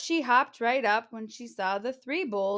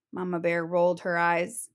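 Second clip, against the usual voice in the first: ordinary delivery, nothing marked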